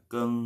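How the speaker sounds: keng ending in a nasal ng sound. Shown as a demonstration. Teacher repeating keng slowly for students, nasal ending. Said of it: "pakai sengau hidung".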